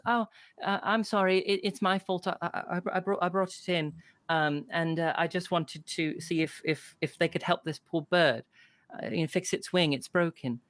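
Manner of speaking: put-on a small girl owning up to the barkeep; little girl apologizing for the bird. GM voices little girl Irina.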